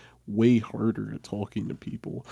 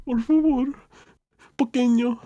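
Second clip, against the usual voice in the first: put-on tiny pleading child; 7 dB louder than usual. Small child pleading for water.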